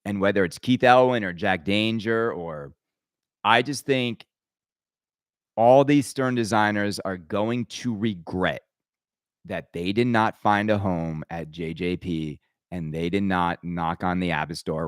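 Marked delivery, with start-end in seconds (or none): none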